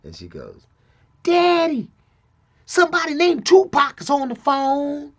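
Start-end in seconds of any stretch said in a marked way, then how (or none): 1.2-5.1 high, loud child imitation; little daughter. Seven-year-old telling daddy about a caller, high imitated child voice. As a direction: announce it like a young child, pitched way up. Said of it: "Like that".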